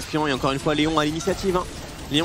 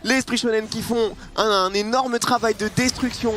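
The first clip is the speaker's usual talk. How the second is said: esports caster; 6 semitones higher than usual. Real, not acted. Caster hypes team's teamfight win.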